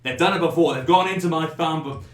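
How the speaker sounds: put-on agitated drunk hurling accusations; angry yelled accusation. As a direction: denounce them at full volume. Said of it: "Jake is yelling".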